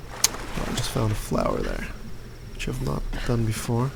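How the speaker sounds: whispered aside. Real, not acted. Quiet aside while playing.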